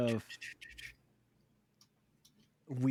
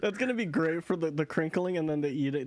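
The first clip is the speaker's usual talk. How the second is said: ordinary talk, nothing marked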